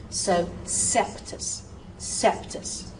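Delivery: septus, slow and soft, P and T both clear; crisp consonants. Shown as a demonstration. Teacher models coeptus with a clear P and T. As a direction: separate the P and T in septus. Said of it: "notice I'm saying that P and I'm saying that T".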